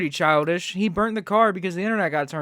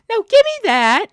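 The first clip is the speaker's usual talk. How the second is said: high, loud mocking squeak of Violet; 9 dB louder than usual. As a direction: screech it back at her like a whiny kid. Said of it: "Violet's like".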